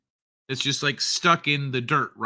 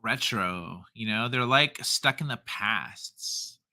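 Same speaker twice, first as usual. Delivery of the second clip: put-on low, drawn-out Valley girl, trailing softer; 4 semitones lower, 6 dB quieter, slower than usual. Host riffing on flyover states, dropping lower in Valley girl voice. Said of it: "read that like a Valley girl".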